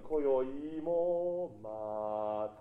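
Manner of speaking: very slow, drawn-out 今宵もまた明るい; not ending here. Shown as a demonstration. Director shows the choir the verse must carry on, slow. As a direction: stretch it out so the phrase stays open. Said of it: "まだここでは終わらないよ".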